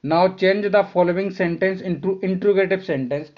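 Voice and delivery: dictating the exam question; slow reading aloud. Shown as a demonstration. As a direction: read the question out slowly and clearly. Teacher reads question slowly. (शिक्षक का धीमा, साफ़ पाठ)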